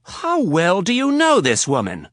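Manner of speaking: sharp, high, slow accusing question; angry demand. Acted. Jealous fiancée confronting Rapp, angry and high. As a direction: demand it of him with rising anger.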